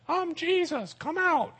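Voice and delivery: very high, soft falsetto ghost voice; hammed-up ghost call, tiny and high; pretend ghost. Preacher playing a ghost luring Peter out, in a high, soft put-on voice. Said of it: "if I was a ghost".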